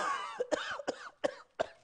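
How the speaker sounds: repeated high, hacking mock coughs; imitated coughing up water. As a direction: cough as if clearing swallowed water. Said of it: "coughing up water on the side".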